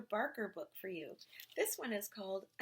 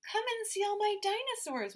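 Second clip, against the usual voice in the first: much higher, louder invitation; eager boy; 12 semitones higher, 6 dB louder than usual. A narrator's reading. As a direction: show off proudly. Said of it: "Morgie said".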